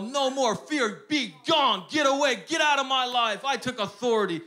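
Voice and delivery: high, loud shouted rebuke; full-force declaration against fear; declaring. Retold or imitated. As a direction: command the fear to leave. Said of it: "I just started declaring".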